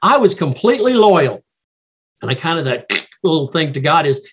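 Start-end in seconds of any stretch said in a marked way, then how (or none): 0.0-1.4 insistent high emphasis on "completely loyal"; emphatic. Acted. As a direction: stress on completely, pitched high. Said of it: "putting some emphasis on it".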